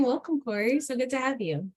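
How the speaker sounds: usual speaking voice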